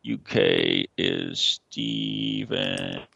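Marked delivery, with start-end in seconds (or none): none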